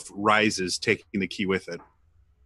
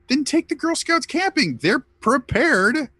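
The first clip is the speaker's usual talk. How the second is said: higher, emphatic mock protest; indignant rant; 14 semitones higher than usual. Real, not acted. Ranting for the Girl Scouts.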